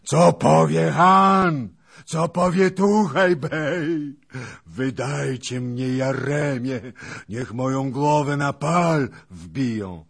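high, drawn-out roar of despair; loud anguished raging. A narrator's reading. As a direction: bellow like a wounded beast. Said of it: "ryczał dalej hetman".

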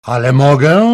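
threatening king. A narrator's reading. King threatening the woman who resists, loud. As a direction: hit her with it, loud.

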